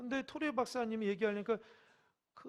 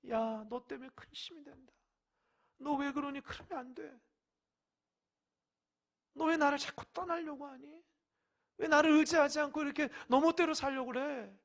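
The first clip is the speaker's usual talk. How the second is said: God's grieved rebuke; 6 semitones higher, 6 dB louder than usual. Acted. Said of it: "이런 소리가 하나님 들을 수 있어야 돼요".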